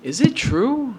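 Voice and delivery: high, amazed question; old friend asking in amazement. Retold or imitated. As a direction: ask it in disbelief. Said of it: "And he goes".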